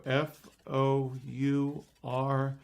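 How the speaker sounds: slow, spaced-out letters in "F O U R"; deliberate spelling. Acted. Kids showing the magician how it's spelled, slow and deliberate. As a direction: lay out "F O U R" one letter at a time.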